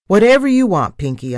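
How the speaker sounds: loud, high, giving in; exasperated outburst. A narrator's reading. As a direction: throw up your hands and cave in. Geronimo giving in loudly.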